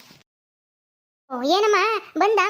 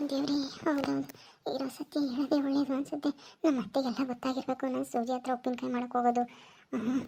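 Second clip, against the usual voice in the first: much softer inner voice; daughter-in-law decides inwardly to confess, softer; inner thoughts; 5 semitones lower, 12 dB quieter than usual. Acted. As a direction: murmur it to yourself, resigned. Daughter-in-law's resigned inner thoughts.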